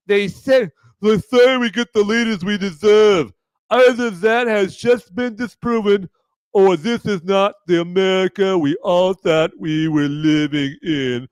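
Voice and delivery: mock Mark Hamill. Streamer reads Hamill's post in a mock whiny voice. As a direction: sneer it out like a whiny celebrity. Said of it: "Mark Hamill screaming".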